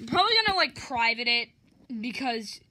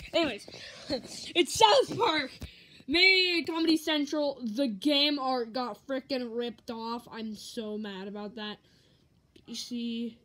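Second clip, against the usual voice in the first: ordinary talk, nothing marked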